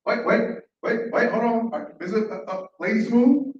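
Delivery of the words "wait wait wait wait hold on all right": ordinary delivery, nothing marked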